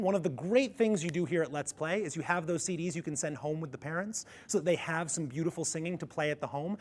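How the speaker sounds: ordinary delivery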